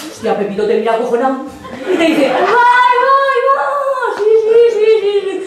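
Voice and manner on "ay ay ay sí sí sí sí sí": put-on scared boy's high yeses. Scared Pepito answers.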